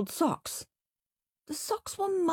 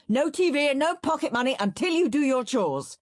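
dad laying down the law; 9 semitones higher, 4 dB louder than usual. A narrator's reading. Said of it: "said Dad, running in".

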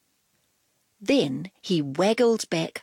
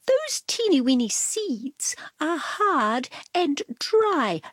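high, prim explaining voice; Cat voice; 7 semitones higher than usual. Acted. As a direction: lecture like a superior cat.

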